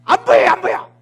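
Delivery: high, loud, fed-up shout; angry shout. Acted. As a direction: snap it twice in irritation. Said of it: "성질 나가지고 확 하는 거예요". (화난 사환이 엘리야에게 버럭 외침)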